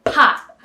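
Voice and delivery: sudden loud popped word. Retold or imitated. Popping awake the woman sleeping on her shoulder, loud. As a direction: snap it out to jolt her awake. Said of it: "I just went".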